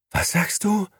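high, stunned question; stunned disbelief. A narrator's reading. Gigi stunned by the news, asking Beppo in disbelief. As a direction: ask in shock. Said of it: "setzte sich fassungslos".

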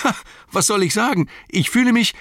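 laughing joy. Acted. Sam tells Gandalf how he feels, high elated cry. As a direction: bubble over with delight. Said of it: "lachend sprang er vom Bett auf".

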